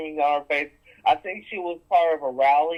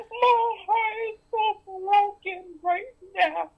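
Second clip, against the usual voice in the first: high, slow, fake sobbing voice; sarcastic whimpering falsetto; mock crying; slower than usual. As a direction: ham up crocodile tears. Mocking mother's fake sorrow.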